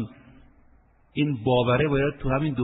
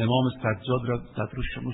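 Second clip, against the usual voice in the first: ordinary delivery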